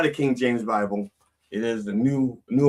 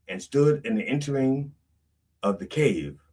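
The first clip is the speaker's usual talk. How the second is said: ordinary talk, nothing marked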